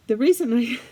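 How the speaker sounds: ordinary talk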